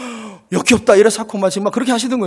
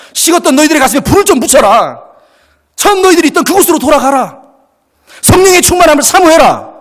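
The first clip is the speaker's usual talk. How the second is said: fiery exhortation; 9 semitones higher, 6 dB louder than usual. Acted. Preacher's rising exhortation.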